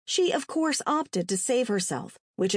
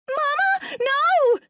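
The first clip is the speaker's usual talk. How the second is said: very high, loud wailing scream; anguished child's cry, pitched way up; 6 dB louder, much slower than usual. A narrator's reading. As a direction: scream in horror for your mother. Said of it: "Terry screamed".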